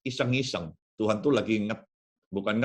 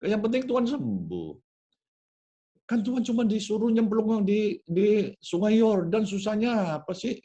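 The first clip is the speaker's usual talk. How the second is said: casual persuading; 8 semitones higher than usual. Acted. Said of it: "pakai bahasa saya sehari-hari".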